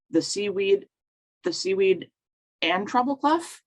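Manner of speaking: halting, unsure reading of a title, rising into a question. Real, not acted. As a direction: feel your way through the title, pitch climbing as you check it. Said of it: "Did I get that right?".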